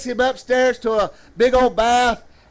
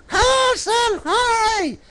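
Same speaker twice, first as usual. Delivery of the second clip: very high, drawn-out puppet squeak; high puppet mimicking a lady calling; 11 semitones higher, slower than usual. Puppet answers what the lady did.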